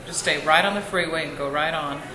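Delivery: ordinary talk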